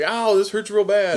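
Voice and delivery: half-hearted imitation of pain; mock pain. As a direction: play the agony as a joke. Acting out Mance burning.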